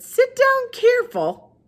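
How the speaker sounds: put-on high, very slow old woman; Gloria Dump voice. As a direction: draw the name out slowly, high up.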